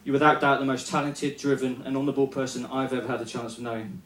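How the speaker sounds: sincere tribute. Real, not acted. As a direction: speak it from the heart, quietly. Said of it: "On a serious note".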